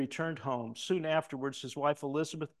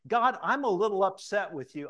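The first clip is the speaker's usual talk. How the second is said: wife's complaint; 6 semitones higher, 7 dB louder than usual. Retold or imitated. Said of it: "she said".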